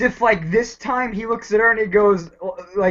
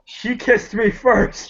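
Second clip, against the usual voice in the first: slow excuse; much slower than usual. Acted. Doctor excuses himself to River.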